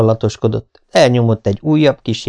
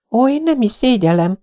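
explaining; 14 semitones higher than usual. A narrator's reading. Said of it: "magyarázta Anne".

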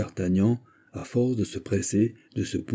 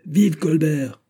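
high, projected cheer; rallying cry; 8 semitones higher, 4 dB louder, much slower than usual. A narrator's reading. Men dragging the condemned away cry out, rallying cry. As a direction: shout it as a rescuers' slogan. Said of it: "Ceux qui les entraînaient criaient".

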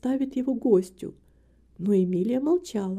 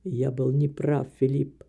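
put-on contrite old man; 8 semitones lower than usual. A narrator's reading. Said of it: "ответил мистер Грэм". (старик признаёт вину, медленно и низко)

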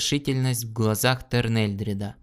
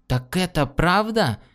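higher, furious shout; furious cry; 6 semitones higher than usual. Acted. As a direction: cry out in a rage. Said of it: "в бешенстве вскричал Джаларн".

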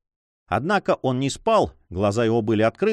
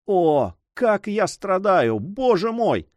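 slow, high anguished cry out; a slow pained outcry, pitched up; 6 semitones higher, slower than usual. A narrator's reading. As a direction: wail it in pain to God. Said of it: "вдруг громко вскрикнул".

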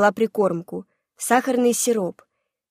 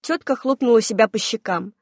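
ordinary delivery, nothing marked

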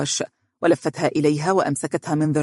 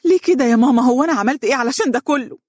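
high, loud plea of a distraught daughter; pitched-up, imploring wail; tearful pleading; 9 semitones higher, 5 dB louder, faster than usual. A narrator's reading. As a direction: beg through acted tears. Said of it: "بكت دنيا بين يدي أمها وهي تتضرع لها قائلة".

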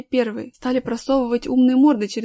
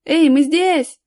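loud, raised call for attention; shouting; 5 semitones higher, 8 dB louder, much slower than usual. A narrator's reading. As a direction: call out to searchers, high and loud. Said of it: "закричал Аркаша". (громкий крик, зов)

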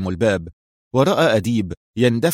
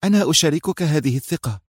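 raised-pitch, keen exclamation; exclaiming; 5 semitones higher than usual. A narrator's reading. Mona backing Hossam's confidence, exclaiming. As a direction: agree keenly in a higher voice. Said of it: "هتفت منى".

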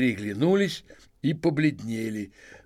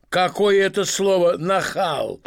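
loud, slow and higher, offended; angry shout; 6 semitones higher, 6 dB louder than usual. Acted. Masked man shouting at the treasurer, angry and loud. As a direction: roar the insult back at him. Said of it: "крикнул мужчина с павлиными перьями".

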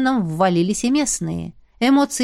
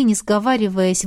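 plain reading voice

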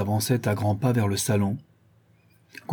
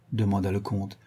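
usual speaking voice

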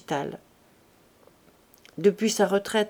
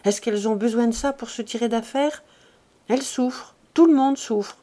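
raised pitch, dismissive midwife; indignant, higher-voiced protest; scornful grumble; 3 semitones higher than usual. A narrator's reading. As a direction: brush the suffering off with contempt. Scornful midwife complains about pleading family.

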